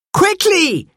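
urgent, high-pitched call; high cry of alarm. A narrator's reading. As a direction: call the others over in a hurry. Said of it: "he called to the others".

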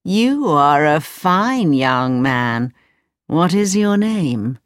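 put-on slow, deliberate old man voice. Old man sizes up young Aladdin, slow old man voice. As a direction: stretch the words like an old man. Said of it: "said the old man".

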